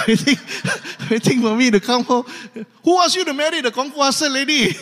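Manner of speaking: loud mock exasperation with laughter; laughing outburst. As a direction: build the complaint into a laughing shout. Complaining husband climaxes, laughing.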